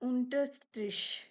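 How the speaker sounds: Unterstrich said slowly, German way, OON-ter-shtrish; German word Unterstrich. Shown as a demonstration. Teacher modelling German for students. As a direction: spell out Unterstrich syllable by syllable. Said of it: "उसको हम लोग बोलते हैं".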